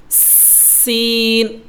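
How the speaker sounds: সিন drawn out slowly with air escaping; airy sin. Shown as a demonstration. Teacher showing air flowing out on সিন. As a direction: let breath hiss through সিন. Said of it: "সিন বলে একটা বাতাস বের হবে".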